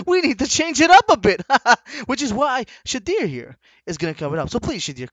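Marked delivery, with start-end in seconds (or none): 0.1-1.4 loud, high mocking repeat; loud mock quote. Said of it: "saying that we need to change". 1.5-2.0 loud laugh. Real, not acted. Laughing at own mock.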